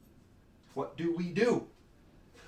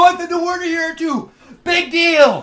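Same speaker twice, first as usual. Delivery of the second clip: loud, higher dismissive yell; defiant shout; 9 semitones higher, 6 dB louder than usual. Acted. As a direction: throw it back defiantly.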